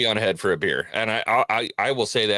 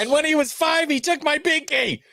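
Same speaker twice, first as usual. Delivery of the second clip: victim's testimony. Acted. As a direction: play a wounded victim recalling a childhood wrong. Joke voicing Taffer's childhood victim.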